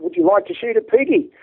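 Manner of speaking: high, loud Aussie invitation; Australian accent. Acted. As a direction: imitate an eager Australian lad. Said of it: "kill me for this Australian accent".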